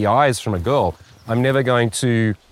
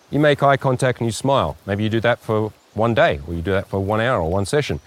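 ordinary talk, nothing marked

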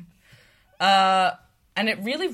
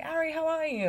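high put-on British greeting; British accent; 10 semitones higher than usual. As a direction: lightly ask it in a British accent. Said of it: "doing my little British accent".

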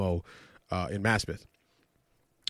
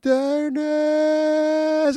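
high, drawn-out call for his son; imitating his uncle calling out. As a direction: call out the boy's name in a panic. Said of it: "I'm like".

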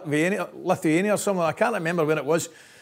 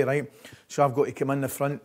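normal talking voice

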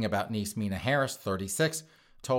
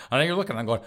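ordinary talk

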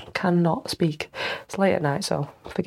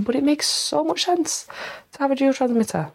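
excited; 8 semitones higher than usual. Real, not acted. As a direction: gush over how much sense it makes. Enthusing over dual transmitter.

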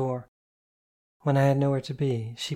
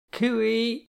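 a high call through a window; sing-song call; 10 semitones higher than usual. A narrator's reading. Penny outside the glass, calling in sing-song. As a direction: call out to get them to open up. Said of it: "A muffled call".

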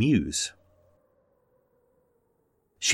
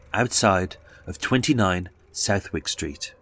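usual speaking voice

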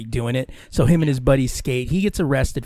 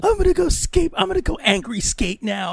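high mock pout, falling off at the end; mock angsty skater kid; sulky teen; 10 semitones higher than usual. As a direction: announce it like a huffy teenager.

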